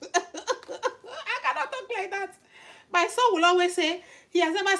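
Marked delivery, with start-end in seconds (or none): 0.0-2.3 high, slow words through laughter; helpless amused high-pitched protest; laughing. Real, not acted. Laughing at herself.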